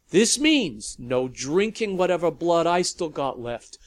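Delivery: loud growling ultimatum; barking. A narrator's reading. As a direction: bark the house rules at them. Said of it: "Lavinia barked at the vampires".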